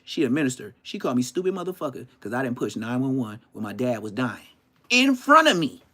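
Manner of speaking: ordinary talk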